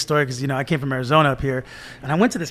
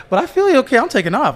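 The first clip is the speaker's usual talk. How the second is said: retold nervous agreement; 9 semitones higher than usual.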